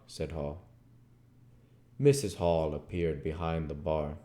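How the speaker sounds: ordinary talk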